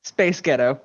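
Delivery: two words in an American accent. Shown as a demonstration. Teasing co-host springs two words on friend, American accent. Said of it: "in an American accent".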